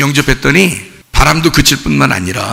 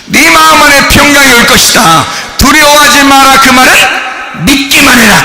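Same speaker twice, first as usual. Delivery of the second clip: high, shouted sermon exhortation; full-force preaching; 11 semitones higher, 4 dB louder than usual. Acted. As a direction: shout the commands out, pitched well up. (높은 목소리로 힘껏 외치는 설교)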